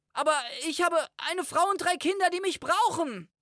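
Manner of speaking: fast, very high frantic begging; desperate plea. A narrator's reading. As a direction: beg in a rush, voice way up. Desperate plea for his life. (verzweifelt hoch und hastig flehend)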